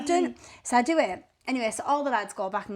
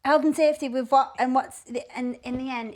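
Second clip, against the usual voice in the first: usual speaking voice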